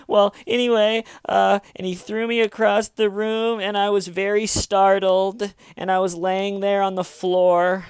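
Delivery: high, pushed imitation of fake distress; mock distraught. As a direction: launch back into the put-on upset act. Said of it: "in the distraught tone of voice".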